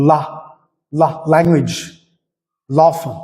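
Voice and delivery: ലാ and ലാംഗ്വേജ് with a dark, full l; dark l demo. Shown as a demonstration. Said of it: "ഇങ്ങനെയുള്ള വാക്കുകളൊക്കെ". (Dark ല ഉച്ചാരണം)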